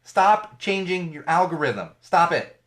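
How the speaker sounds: ordinary talk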